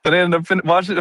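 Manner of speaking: ordinary talk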